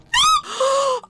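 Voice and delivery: sharp high gasp of shock; startled loud intake of breath. Real, not acted. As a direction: gasp in sudden alarm. Sudden shock in game.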